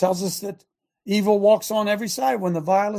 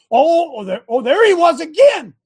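higher and louder, mock astonishment at each photo; mock surprise; 6 semitones higher, 8 dB louder than usual. Real, not acted. Host mocking the court's photos for the viewers, in mock surprise. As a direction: play it up like a sarcastic gotcha reveal.

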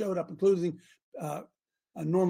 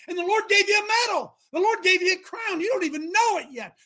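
high, exultant sermon shout; fervent preaching; 8 semitones higher, 5 dB louder than usual. Real, not acted. Preacher urging the persecuted to rejoice, fervent and high. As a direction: preach it at full force, voice lifted high.

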